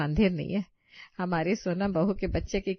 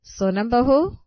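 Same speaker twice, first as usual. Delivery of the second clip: much louder, higher call of her name; 6 semitones higher, 8 dB louder, slower than usual. Acted. Mother-in-law calling sleeping Sonam louder and higher. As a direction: raise your voice to wake her. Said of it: "सास कई बार सोनम को आवाज देती है".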